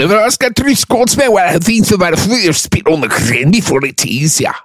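high, garbled mock Scots; exaggerated Scottish warrior talk; Scottish accent. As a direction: do a thick, rambling Scottish accent. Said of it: "let me hear your Scottish accent".

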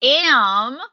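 a hint of Tammy Faye's folksy Minnesota accent; cute, light Minnesota accent. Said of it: "the way she said Jim".

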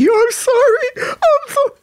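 high, slow fake sobbing; hammed-up whiny fake cry; mock crying. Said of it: "pretend like you crying".

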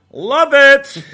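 high, loud cheer; excited shout. Real, not acted. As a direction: yell it with joy. Sudden shout.

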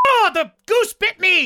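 surprised yelp. Real, not acted. Bitten by goose.

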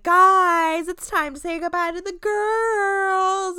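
imitating a drunk host calling the guys together; drunk announcement. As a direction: draw it out like a wasted hostess. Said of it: "she's drunk at shit at this point".

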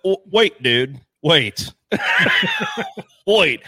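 loud, half-laughing alarm; panicked protest. Retold or imitated. Nervous rider protests to boat guy, loud and half-laughing.

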